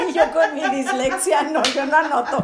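giggling at her own joke; laughing. Real, not acted. Joking about dyslexia on a Ouija board, laughing.

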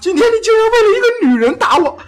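very high, loud mock sobbing; crying while talking. Slapped boy accusing his father, hammed-up crying. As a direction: wail it out like a betrayed child. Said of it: "一边哭一边说".

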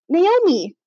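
put-on high, delighted child-like call; giggly. A narrator's reading. Little ghost sister greets Naomi, giggly and high. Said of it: "Nita giggled".

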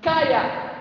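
loud, firm command to the wind; Jesus rebuking the wind, loud and firm; commanding rebuke. Acted. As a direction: order the storm to stop.

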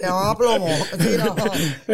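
habla entre risas, algo más alto; riendo. Real, not acted. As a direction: suelta las palabras riéndose de verdad. Riendo del chiste de plomo. (riéndose del chiste de su compañero)

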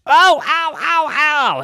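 high, loud "ow" cries; acted tumbling yelps. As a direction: yelp as if being flipped about. Miles tumbled around in cube.

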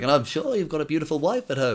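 high, kindly put-on voice; mock old-man lilt; little old man. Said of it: "And he's like".

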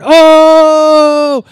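sudden high, loud "Oh!" of astonishment; a crowd of followers gasping in shock; amazed gasp. Retold or imitated. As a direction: gasp out loud as if struck with wonder. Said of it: "I imagine all the disciples going".

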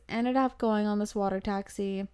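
ordinary talk

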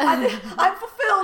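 high, loud claim through laughter; mock fulfilled boast. Cohost joins the parody of a happy woman, mock boasting.

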